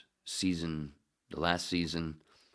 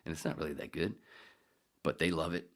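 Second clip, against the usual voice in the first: usual speaking voice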